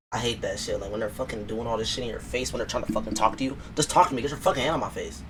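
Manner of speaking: ordinary talk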